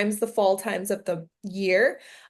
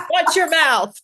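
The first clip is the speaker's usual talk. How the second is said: louder, half-joking warning; mock scolding; 6 semitones higher, 7 dB louder, slower than usual. Co-host jokingly scolding the guest, playful and louder. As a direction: tease her like a strict aunt.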